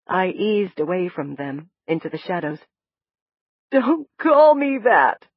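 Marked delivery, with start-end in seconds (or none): 3.7-5.3 slow, raised, tearful plea; sobbing plea. A narrator's reading. Woman begging not to be called Summer, tearful. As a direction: drag it out through tears. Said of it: "the woman sobbed".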